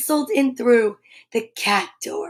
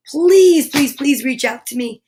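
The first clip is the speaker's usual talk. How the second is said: ordinary delivery, nothing marked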